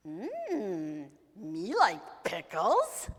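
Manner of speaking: slow, loud, savouring goblin; pickle-loving goblin. Acted. Goblin tastes the pickle, slow greedy relish. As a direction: relish it like a simple-minded monster.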